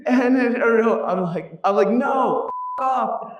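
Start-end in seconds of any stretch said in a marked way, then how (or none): 1.9-3.4 much higher curse-off; exasperated. Retold or imitated. Speaker to auto driver, exasperated. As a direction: tell him to get lost.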